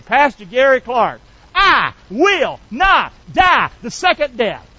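confident declaration. Shown as a demonstration. Pastor modelling the church's confident vow, slow and firm. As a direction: speak it slowly with confidence. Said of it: "Can you say to me with confidence".

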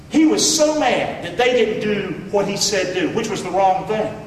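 usual speaking voice

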